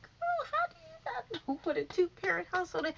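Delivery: put-on high, disbelieving "girl, how"; mocking high-pitched disbelief; incredulous. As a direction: ask it up high in total disbelief. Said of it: "But I'm just like".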